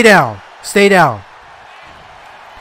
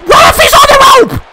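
very high, loud yell; outraged scream; 6 dB louder than usual. Real, not acted. Player alerting the ref to a rope break, outraged. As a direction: scream the protest at the official. Screaming at referee over foul.

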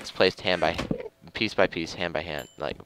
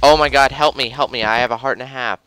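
high, loud cry for help; panicked shout; 6 semitones higher, 7 dB louder than usual. Real, not acted. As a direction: yell for help as the creeper closes in. Creeper attack, calling for help.

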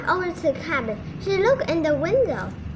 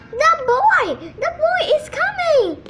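high, loud alarmed yell; raised, high-pitched cry of alarm; 11 semitones higher, 4 dB louder than usual. A narrator's reading. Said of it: "Wolf started to yell".

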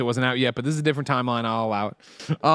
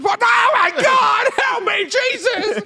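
much higher, louder panicked shriek; mock screaming panic; 5 dB louder, much slower than usual. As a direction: play a terrified passenger crying for help.

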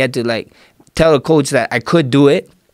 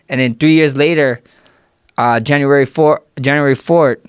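ordinary delivery

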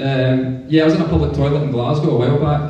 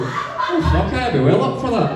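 high-pitched, excited self-quote; reply eagerly, pitched well up; 6 semitones higher than usual. Retold or imitated. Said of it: "so all excited".

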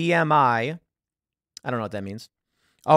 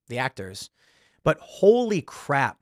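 ordinary delivery

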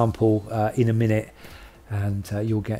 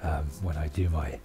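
slipping into a lower, trailer-style voiceover tone; deep voiceover voice; 7 semitones lower, 8 dB quieter than usual. Shown as a demonstration. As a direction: drop low like a movie narrator, half-joking. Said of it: "my voiceover voice".

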